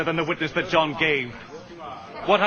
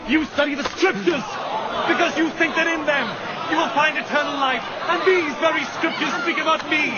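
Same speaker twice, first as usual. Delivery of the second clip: rising rebuke; 7 semitones higher than usual. Acted. Jesus rebuking the crowd who will not believe, raised voice. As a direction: lift the voice in rebuke.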